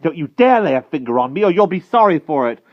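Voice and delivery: loud, high, threatening protest; defiant cry. A narrator's reading. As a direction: warn them off at a shout. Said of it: "cried the wart".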